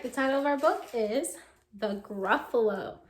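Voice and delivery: plain reading voice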